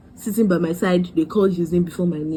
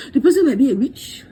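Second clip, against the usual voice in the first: mock whisper; 5 semitones higher than usual. Real, not acted. Warning about strangers.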